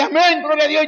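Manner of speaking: high, loud, tearful cry of praise; mock weeping worshipper. Congregation answering the preacher, mock-tearful high cry. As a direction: wail it like a sobbing congregant.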